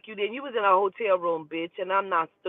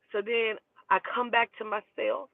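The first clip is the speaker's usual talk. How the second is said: ordinary talk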